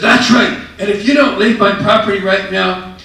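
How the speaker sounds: very high, loud enraged shout; furious shout. Retold or imitated. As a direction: scream it like you're about to call the cops. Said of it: "And he was just furious".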